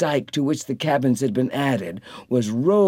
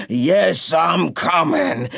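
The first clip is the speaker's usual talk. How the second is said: growling shout; 2 semitones higher, slower than usual. A narrator's reading. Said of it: "growled and shouted ahead".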